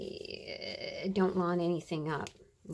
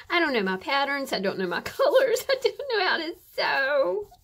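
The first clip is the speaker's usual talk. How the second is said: louder and higher, laughing into a hammed-up wail; mock wail laughing; 6 semitones higher, 9 dB louder than usual. Crafter laughing at herself in hammed-up despair. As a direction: pretend to despair while cracking up.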